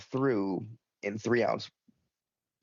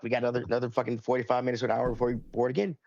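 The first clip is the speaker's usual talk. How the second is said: ordinary talk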